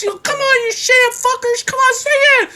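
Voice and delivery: very high, mock furious screech; hammed-up stage tantrum; screaming singer. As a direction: berate the crowd to sing along. Singer screaming back at crowd.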